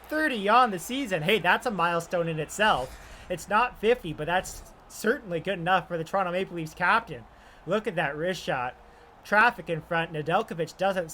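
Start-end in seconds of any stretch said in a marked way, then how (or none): none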